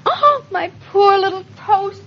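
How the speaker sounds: mock lament. Mourning burnt toast.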